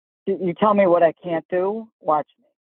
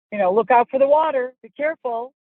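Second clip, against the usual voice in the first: nagging worried voice; 7 semitones higher, 4 dB louder than usual. Retold or imitated. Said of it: "that's what this idiot's always saying".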